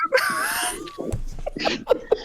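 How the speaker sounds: high, shrill burst of laughter; shrieking laugh. Real, not acted. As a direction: crack up screaming. Big laugh.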